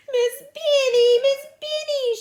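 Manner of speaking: high, loud tattling wail; crying out. Acted. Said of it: "she cried".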